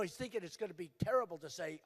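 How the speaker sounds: ordinary talk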